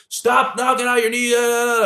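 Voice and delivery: mock drill-instructor yell. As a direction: imitate an instructor yelling in a recruit's ear. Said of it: "just yelling in my ear".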